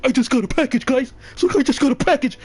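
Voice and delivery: high, loud hyped-up yelling of the roommate's line; overexcited Team Art. Retold or imitated. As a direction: play the roommate bursting with fake glee over a package. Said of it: "he's like".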